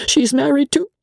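high, faltering announcement; timid companion voice, pitched high; nervous hesitation. A narrator's reading. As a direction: break off before the dreaded name. Briggs haltingly breaks shocking news.

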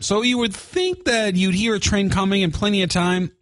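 ordinary talk, nothing marked

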